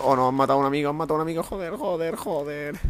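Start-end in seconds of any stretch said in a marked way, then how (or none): 1.4-2.8 soft frustrated swearing; cursing under breath. Real, not acted. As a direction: mutter the curses quietly. Friend just killed.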